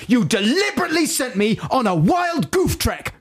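high-pitched mock-furious shout; imitated shout. Retelling the fuming bar manager's outburst, high mock shout. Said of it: "He shouted".

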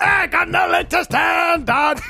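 high, slow shouted pirate. Acted. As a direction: bellow like a one-legged pirate. Pirate character voice.

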